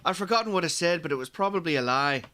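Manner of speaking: put-on passable Irish accent, slightly Scottish; Irish accent attempt. Co-host retries the line for his friend in a passable Irish accent. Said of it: "the first one wasn't terrible".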